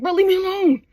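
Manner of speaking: high-pitched, whiny mock irritation; acted exasperated brush-off; irritated. Irritated son to parents asking about girls, exasperated. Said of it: "he's irritated".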